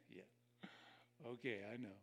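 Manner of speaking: mildly sarcastic cynic muttering; cynical. Retold or imitated. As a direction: brush it off like you've heard it all. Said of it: "It's the sound of a cynic that says".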